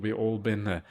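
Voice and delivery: normal talking voice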